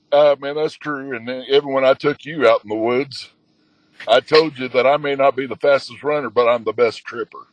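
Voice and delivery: usual speaking voice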